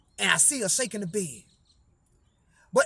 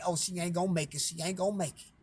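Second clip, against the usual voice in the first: hushed, softer mock gossip; gossiping relatives; 5 semitones lower, 10 dB quieter than usual. Relatives calling around, mimicked in a hushed murmur. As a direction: mimic the doubters in a low murmur. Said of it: "'cause they went to calling everybody".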